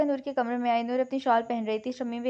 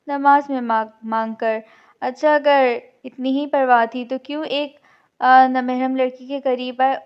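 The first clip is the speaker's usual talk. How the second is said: loud mocking taunt; 9 dB louder than usual. Arsh mocks Noor's prayers in front of the crowd, loud and scornful. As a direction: sneer at her piety. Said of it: "अर्श ने बात हवा में उछाल दी".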